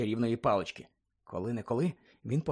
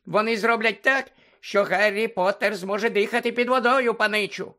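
Dobby the house-elf squeaking at Harry; squeaky house-elf; 7 semitones higher, 5 dB louder than usual. Acted. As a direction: squeak it like an anxious little elf.

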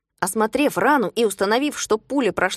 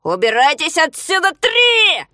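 shouted final count, leaping high; кричащий Ригль; 7 semitones higher, 5 dB louder, slower than usual. A narrator's reading. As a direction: yell the last warning, climbing to a scream. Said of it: "закричал между тем Ригль". (крик с резким взлётом в конце)

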